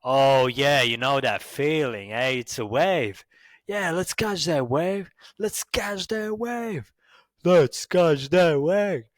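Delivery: hammed-up California surfer, climbing high; drawn-out, high mock Californian, building to loud; California accent. Shown as a demonstration. A surfer urging friends onto a wave, exaggerated California accent. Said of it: "in California, they speak like".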